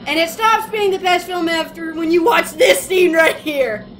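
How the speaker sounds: shouted over the film clip; loud exclamation. Real, not acted. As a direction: raise the voice in a loud outburst. Shouts over the clip's scene.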